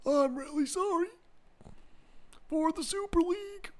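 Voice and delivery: very high, soft put-on voice; mock apology. Streamer retrying the Super League apology impression, high soft voice. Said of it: "I tried to do it".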